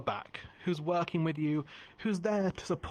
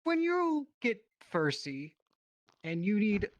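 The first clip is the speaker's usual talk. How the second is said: hard sell ad; 6 semitones higher than usual. Acted. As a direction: sell it like a TV salesman. Improv infomercial pitch.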